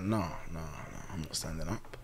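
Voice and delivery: low, hushed imitation of refusing; soft, reluctant acted refusal; quiet refusal. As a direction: mumble a retold no, very softly. Maureen refusing the pastor, retold.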